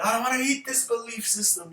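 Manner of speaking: high, loud mock child's whine; hammed-up kid refusing food; protesting kid. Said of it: "the kid doesn't wanna eat it".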